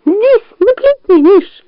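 very high little squeak; tiny high-pitched imitation fox voice; squeaky fake fox. A narrator's reading. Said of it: "сказал Шмык голосом лисы".

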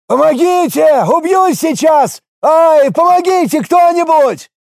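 high, drawn-out cries for help; yelling even harder. A narrator's reading. Leaning out of the window to the crowd, yelling even harder. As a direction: scream desperately for someone to help. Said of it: "заорал ещё громче".

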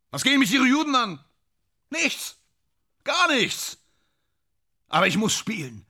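very high, strained indignation; agitated protest. Acted. Actor railing about the role forced on him, agitated. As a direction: protest hotly in separate bursts.

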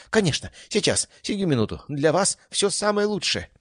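eager servant voice. A narrator's reading. Eager cook nodding energetically.

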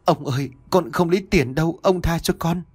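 high-pitched, crying plea; tearful begging. A narrator's reading. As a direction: plead through tears for release. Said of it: "òa lên khóc nức nở".

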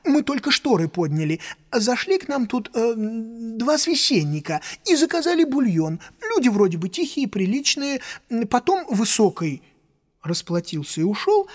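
high excited waiter babble; rapid chattering. Acted. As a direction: gabble it out, words tumbling. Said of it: "затараторил он".

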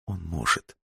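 very soft, heavy admission; slowly, subdued. A narrator's reading. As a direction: say it quietly and deliberately. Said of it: "сказала она медленно". (медленно и очень тихо)

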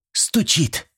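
high-starting, falling "стучит"; assured young woman's answer; confident confirmation. A narrator's reading. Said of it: "уверенно произнесла Зинаида".

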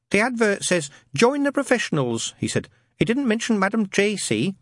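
high, careful lad; nervous young man. Acted. Newton asking Madam Tracy about the advert, timid and high. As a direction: read back the ad shyly.

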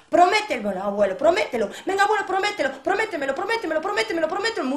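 high, loud repeated pleading; loud insistent child; begging little boy. A narrator's reading. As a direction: beg grandpa over and over like a kid.

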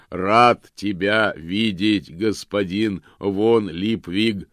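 slow, booming, cheerful golem voice; радостно прогремел. A narrator's reading. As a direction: boom it out joyfully, slowly. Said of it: "радостно прогремел он". (радостный громовой голос голема)

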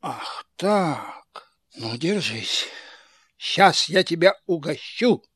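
quiet low threat; low menace. Acted. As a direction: drop into a low, soft threat. Karabas turns menacing.

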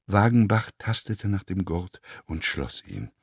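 ordinary delivery

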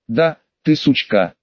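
plain reading voice

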